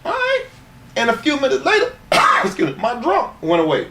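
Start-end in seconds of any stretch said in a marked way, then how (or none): 0.0-0.5 high squeal. Retold or imitated. Snorting coke, retold reaction.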